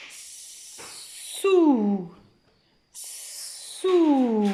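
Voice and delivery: "Sou" as a long hiss into "ouuu"; first sound first. Shown as a demonstration. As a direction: hold the S, then swell into the vowel of "Sou". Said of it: "On commence par le son de la première lettre". (« Sou » avec S sifflé très long)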